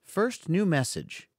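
robot voice reading the greeting; robotic voicemail voice. Acted. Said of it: "the voicemail continued".

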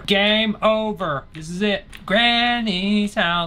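dramatic drawn-out announcement. Pretend. Player announcing the game is ending, slow dramatic proclamation. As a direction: stretch the words out as a grand finale.

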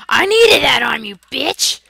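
loud, high, furious yell at the game; real frustrated shout at the screen; angry yell. Real, not acted. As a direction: scream it out in outrage. Character's arm breaks off.

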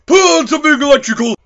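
loud, fast and eager; excited shout. Acted. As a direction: blurt it out gleefully. Excited shout before electrical fire.

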